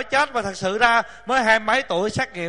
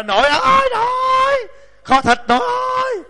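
put-on whining child calling; 4 dB louder than usual. Grandchild begging grandma for stewed meat, high long-drawn whine. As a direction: sing out the call like a pestering kid.

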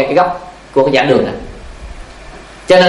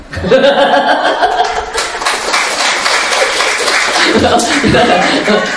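long airy chuckle at his own story; breathy laugh; 6 semitones higher than usual. Real, not acted.

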